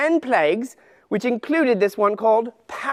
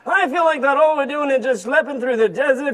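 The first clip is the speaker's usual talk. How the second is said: put-on tired, complaining old camel voice; grumpy old camel. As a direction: moan about aching back and feet. Camel grumbles about the desert.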